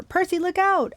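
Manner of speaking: high, urgent acted cry; warning cry. A narrator's reading. Annabeth warning Percy of the whip, urgent cry. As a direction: cry out to warn a friend. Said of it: "Annabeth cried".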